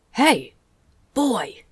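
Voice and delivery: sharp shout across the lawn; calling out. Acted. Opal miner calling to the boy across the lawn, loud shout. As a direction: bark it to get the boy's attention.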